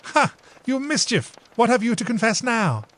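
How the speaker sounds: high, playful mock accusation; amused big sister; teasing sister. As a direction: catch her out fondly. Emily teases again.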